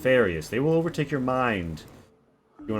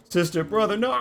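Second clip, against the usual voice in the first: much higher scream of horror; screaming siblings; 10 semitones higher than usual. Acted. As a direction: cry out in horror. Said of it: "Amalia screams".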